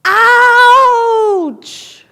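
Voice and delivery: loud high echoed yell; echo yells back. Acted. Mountain echoing the child, loud and high. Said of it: "the mountain yells back".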